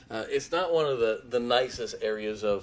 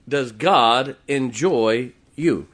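loud, very slow, stretched out; slow preaching emphasis; 10 dB louder, much slower than usual. Acted. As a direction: drag each word out to the crowd. Repeated, drawn out.

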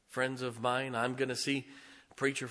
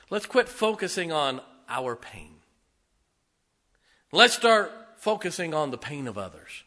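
rousing sermon close; 2 semitones higher, 6 dB louder than usual. Acted. Closing exhortation.

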